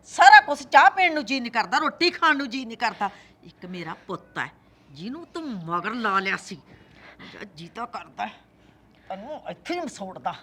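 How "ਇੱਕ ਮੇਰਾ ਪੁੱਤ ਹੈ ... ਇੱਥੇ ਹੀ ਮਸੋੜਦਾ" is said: much softer, lower hissing threat; spiteful low menace. Acted. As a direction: growl it quietly with venom. Mother-in-law spiteful low threat. (ਸੱਸ ਦੀ ਹੌਲੀ ਜ਼ਹਿਰੀਲੀ ਧਮਕੀ)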